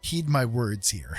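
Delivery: ordinary delivery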